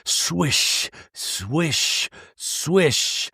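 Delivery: soft spoken swish of waves; swooshing water sound effect; imitated water swooshing. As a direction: mimic waves with separated, hushed swishes. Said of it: "you hear the swooshing of water".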